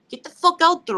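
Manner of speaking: loud, high call to get out there; pumped-up shout to parents; rallying shout. Real, not acted. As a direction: urge the parents on, full voice.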